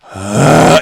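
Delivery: loud imitated jeering "uh"; evil crowd groan. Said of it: "It was like an evil ass".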